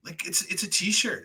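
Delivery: high, exasperated give-me-a-break. Real, not acted. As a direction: throw up your hands at it. Exasperated at plane story.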